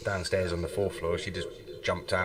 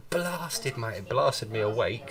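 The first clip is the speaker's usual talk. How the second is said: normal talking voice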